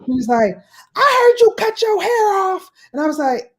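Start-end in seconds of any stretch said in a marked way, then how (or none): 1.0-2.7 imitating niece. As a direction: do the niece, high and young. Niece quoted on phone.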